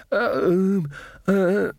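high, halting stammer; stuttered. A narrator's reading. Magrat coming round, high stuttering. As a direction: falter on drawn-out ums. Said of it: "Magrat stuttered".

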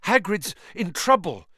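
high, loud and breathless; breathless fear. A narrator's reading. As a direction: gasp it out in fear. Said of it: "breathing very fast".

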